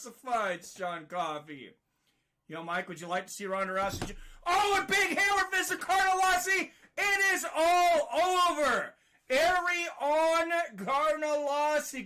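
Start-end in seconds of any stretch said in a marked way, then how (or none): 4.5-12.0 excited finish call. Real, not acted. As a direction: announce the end with a bang. Fight ends, winner called.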